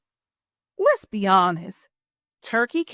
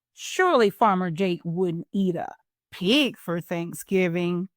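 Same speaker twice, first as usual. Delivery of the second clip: usual speaking voice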